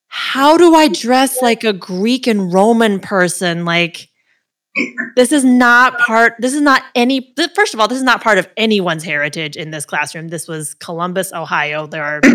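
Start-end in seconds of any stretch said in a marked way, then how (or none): 0.1-10.3 mock exasperation. Kid at home fretting over a Roman costume, loud and exasperated. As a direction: huff it out like a kid baffled by the assignment. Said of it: "And I'm at home like".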